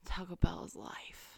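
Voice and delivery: whispered like a sacred motto; barely-there reverent whisper; hushed reverence. Pretend. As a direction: whisper it with devotion. Reverent aside about Taco Bell.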